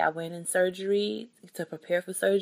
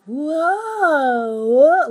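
high, loud startled whoa; jolted, shocked exclamation; startled surprise; 10 semitones higher, 8 dB louder than usual. Retold or imitated. Retold reaction to feeling surgery.

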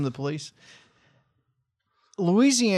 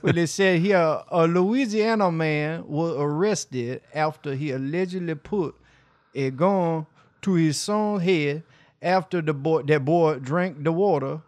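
put-on Louisiana accent. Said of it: "Should we read it like a Louisiana person?".

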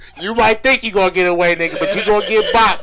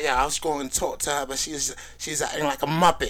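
put-on softer, lower London accent; British accent; 3 semitones lower, 8 dB quieter than usual. Quoting a favorite London saying to friends, British accent. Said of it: "You did the accent pretty good".

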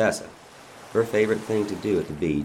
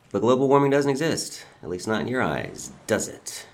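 sarcastic; 6 semitones higher than usual. Acted. Taunting the bound governor.

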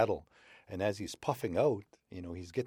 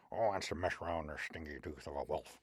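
lower, softer scoffing grumble; grumbling wolverine; 3 semitones lower, 6 dB quieter than usual. Acted. Wolverine scoffing at the wolf's offer, low grumble. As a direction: sneer under your breath.

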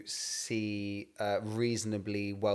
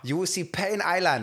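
loud, slow, higher Nigerian parent threat; 8 semitones higher, 4 dB louder than usual. Pretend. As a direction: threaten like a furious Nigerian dad. Continuing the Nigerian parent bit.

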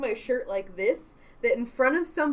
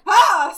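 high, loud mock scream; startled yelp; 10 semitones higher, 13 dB louder than usual. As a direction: yelp as if caught off guard. Re-enacts startled scream when flashed.

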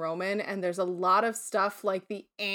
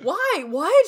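mock surprise; 9 semitones higher, 6 dB louder than usual. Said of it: "I was like".

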